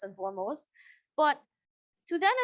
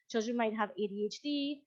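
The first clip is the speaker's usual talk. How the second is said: normal talking voice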